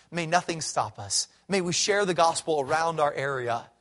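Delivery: ordinary talk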